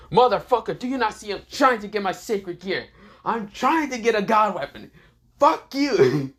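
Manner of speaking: high, exasperated cursing; angry tirade. Acted. Hero cursing the attacker who interrupted him, high angry rant. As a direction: rant at them with the pitch pushed up.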